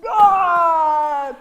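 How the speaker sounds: a sudden, very loud exclamation; loud cry. Acted. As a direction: burst out as the lion pounces. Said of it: "Let me see you".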